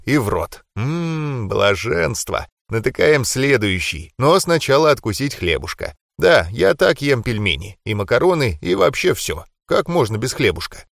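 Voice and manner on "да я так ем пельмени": ordinary delivery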